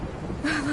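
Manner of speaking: short quiet laugh of relief; soft relieved laugh. Acted. Chloe laughs softly at being together with Max again, soft laugh. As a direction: let a gentle laugh slip out.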